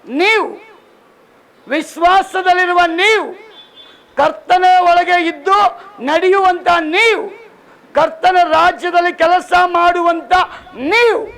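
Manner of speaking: preacher climbing toward the climax; rising exhortation. Real, not acted. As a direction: build the call to a peak. Building exhortation.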